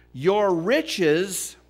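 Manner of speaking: high, loud, driving sermon; impassioned pulpit preaching; full-force preaching. Acted. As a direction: preach it hard to the congregation.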